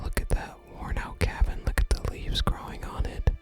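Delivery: usual speaking voice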